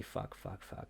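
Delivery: barely audible whispered swears; whispered cursing. Real, not acted. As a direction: breathe the curses out. Muttered curses to himself.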